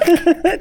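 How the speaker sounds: short high laugh breaking through; real laugh slipping out while trying to hold it in; burst of laughter. Real, not acted. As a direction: let a laugh escape despite trying not to. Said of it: "I'm trying not to laugh".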